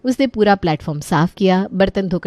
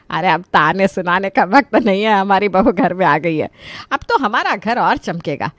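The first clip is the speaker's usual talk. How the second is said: lightly voiced mother-in-law; a hint of an in-law character. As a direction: answer as a mother-in-law, in a slightly altered voice.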